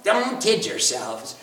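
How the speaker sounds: normal talking voice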